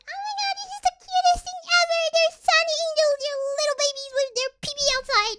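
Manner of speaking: very high, loud, fast squealing fangirl; gushing fangirl. Retold or imitated. Mocking normies gushing over Sunny Angels, squealing high. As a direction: squeal like an excited collector. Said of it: "And I'm like".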